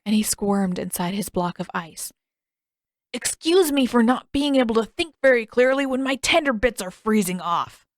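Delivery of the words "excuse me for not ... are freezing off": higher, louder, indignant whine; whiny complaint. A narrator's reading. Sokka whines to his sister about freezing, indignant.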